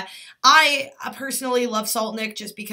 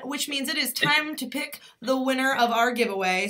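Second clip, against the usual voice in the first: ordinary talk, nothing marked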